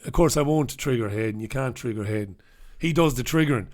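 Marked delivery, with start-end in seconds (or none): none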